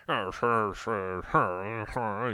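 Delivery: put-on thin, feeble old senator voice repeating sir; mock a frail old politician fumbling; Mitch McConnell's little voice. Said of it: "he does his little voice".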